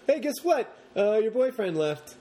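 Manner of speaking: high, chirpy mock caller; mock phone call. Caller phoning the heroine, high mock breezy news. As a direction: pitch up, breezy hammed-up news. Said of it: "She's like".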